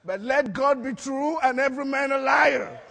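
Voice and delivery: preaching proclamation. Real, not acted. Preacher defending scripture to the congregation, loud proclamation.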